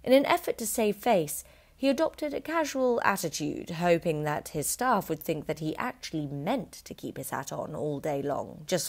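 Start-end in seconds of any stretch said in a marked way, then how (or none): none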